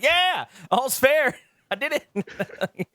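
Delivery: slow, high mock-smug drawl; hammed-up slow smug victory line; smug gloat. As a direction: gloat over the prank, taking your time. Imagined prank, gloating.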